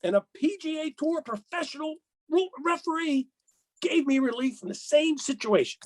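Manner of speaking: high, aggrieved mock golfer; imitating the player. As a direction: mimic a whiny player pleading his case. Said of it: "he said".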